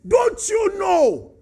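slow, loud, high rebuke; defiant challenge. Retold or imitated. Moses challenging God, slow, loud and defiant. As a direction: draw out the rude question. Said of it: "But he said".

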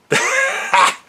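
a quick airy high giggle; breathy high laugh. Real, not acted. Laughing while signing off.

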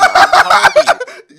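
very high, loud, slow mocking squeal; 1 squeaky taunt.

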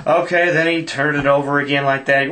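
plain reading voice

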